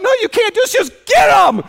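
high, loud frantic order; panicked shout. Retold or imitated. Husband pleading with wife in labour, panicked shout. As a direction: beg her to wait, panicking. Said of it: "I'm like".

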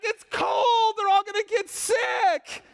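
high, slow, panicked mock yell. Worried volunteer scolding the kids, high mock panicked yell. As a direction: wail it like a worried chaperone. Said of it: "she was just screaming".

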